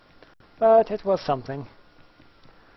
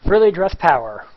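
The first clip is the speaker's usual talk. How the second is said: loud victory cry about the dress; triumphant cheer; 10 dB louder than usual. Acted. Streamer cheering on her frilly dress, loud and proud. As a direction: shout it like a battle slogan.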